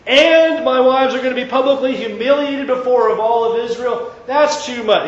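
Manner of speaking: mock-whiny grumbling about the penalty; complaining. What David doesn't say to God, mock complaint. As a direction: whine that it's too rough. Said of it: "David doesn't say".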